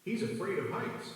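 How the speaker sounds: ordinary delivery, nothing marked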